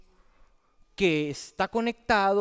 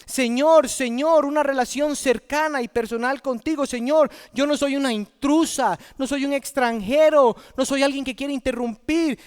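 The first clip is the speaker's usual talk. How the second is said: loud, high, insistent pleading; preacher acting out a desperate appeal; pleading virgins; 4 semitones higher, 5 dB louder than usual. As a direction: beg to be let in as someone who belongs. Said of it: "No, ellas dicen".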